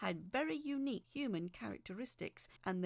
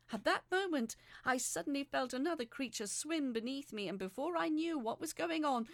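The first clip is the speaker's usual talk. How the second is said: rising excitement; 4 semitones higher, 6 dB louder than usual. A narrator's reading. Climax of underwater rescue.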